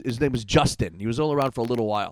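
ordinary talk, nothing marked

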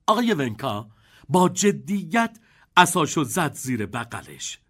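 ordinary delivery, nothing marked

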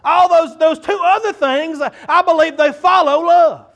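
loud, high, fervent preacher's call; preaching. Acted. Pastor to congregation, preaching loud and fervent. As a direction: pour it out like a revival preacher.

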